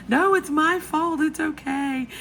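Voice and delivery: very high, insistent vet tech; imitated reassurance. Voicing vet tech reassuring her.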